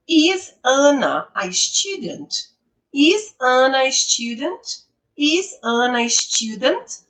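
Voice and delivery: slow model question, STUDENT rising at the end; rising question intonation. Shown as a demonstration. Teacher modelling a yes-no question for students, rising on STUDENT. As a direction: lift the pitch on "student", slowly and clearly. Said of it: "ela sobe no final".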